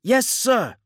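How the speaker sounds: very high, loud acknowledgement; chorused military shout. Acted. Two gunners answering their captain, chorused shout. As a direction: sound off like soldiers answering together. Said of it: "chorused Astin and Nils".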